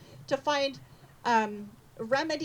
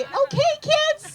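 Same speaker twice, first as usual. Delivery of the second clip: put-on much higher, louder singsong to little kids; preschool teacher voice; 7 dB louder, slower than usual. Shown as a demonstration. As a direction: call the class to attention, bright and high. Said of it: "I might bust out my preschool teacher voice".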